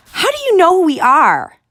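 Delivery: high loud protest; crying out. Acted. Girl protesting the guard, loud cry. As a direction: cry out in outrage. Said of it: "Ella cried".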